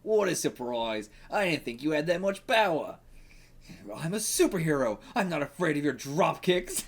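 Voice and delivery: amateur mock Australian accent; attempted Australian accent. Reader voicing the Kangaroo taunting Spider-Man, attempted Australian accent. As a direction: have a go at a hammy Australian accent. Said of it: "I'm gonna try to do an Australian accent".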